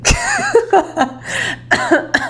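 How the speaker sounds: high-pitched giggling fit; sudden high laugh; real burst of laughter. Real, not acted. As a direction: crack up helplessly. Cracks up after saying legally.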